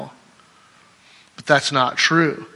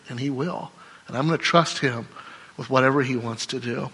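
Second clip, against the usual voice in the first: ordinary delivery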